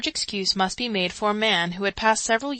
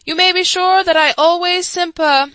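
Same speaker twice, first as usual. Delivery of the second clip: louder, higher speech cut off; louder, higher thin voice; squeaky orator; 10 semitones higher, 11 dB louder than usual. A narrator's reading. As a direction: squeak the speech until drowned out.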